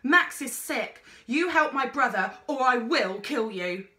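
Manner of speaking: loud furious threat; raging sister; fierce threat. A narrator's reading. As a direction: threaten him to save your brother. Girl threatens explorer.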